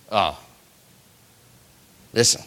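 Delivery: ordinary talk, nothing marked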